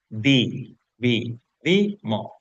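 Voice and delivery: DI, VI, DI, MO as separate beats; syllable by syllable. Shown as a demonstration. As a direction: break "Di, vi, di, mo" apart with a pause between syllables. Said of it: "cuáles son las sílabas".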